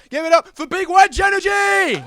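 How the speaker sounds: loud, high announcer hype shout; rally the crowd for the winner; calling out. Real, not acted. Host calls out the winner.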